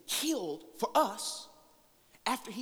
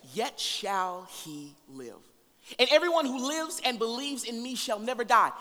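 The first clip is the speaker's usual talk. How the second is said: preaching at full force; 4 semitones higher, 6 dB louder than usual. Acted. Preacher reading Martha's plea to the congregation, full force. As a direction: proclaim each line to the room. Preacher reads the Lazarus passage.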